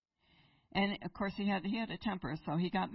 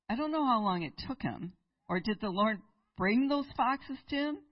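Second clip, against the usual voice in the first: ordinary talk, nothing marked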